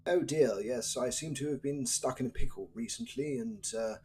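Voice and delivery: posh Queen's English. Acted. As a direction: play a mock-genteel English gent in a Queen's English accent. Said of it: "the elevated British accent, so the Queen's English".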